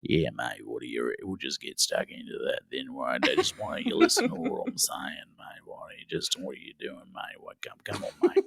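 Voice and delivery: deep Aussie voice sinking into a quiet, voiceless murmur; Ben Mendelsohn. Shown as a demonstration. Australian guest showing his co-hosts his absent-stare act, deep and muttered. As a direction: speak very low in thick Aussie slang, fading almost to a whisper. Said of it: "kind of speak in a deep voice".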